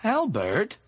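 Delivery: high-pitched questioning call; alarmed call. A narrator's reading. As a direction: call the name in rising alarm. Woman alarmed as companion falters.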